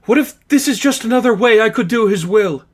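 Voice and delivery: high, loud and tearful, gasping through it; a nun breaking down, pleading to be heard; sobbing nun. Acted. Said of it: "Sister Katya gasped for fresh breath".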